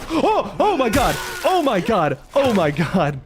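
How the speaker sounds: real fright, a high startled cry that fades over the repeats; startled scream. Real, not acted. Streamer jump-scared by a game monster, screaming in fright. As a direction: yelp in real fear, then keep gasping it out. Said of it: "God, that scared me so bad".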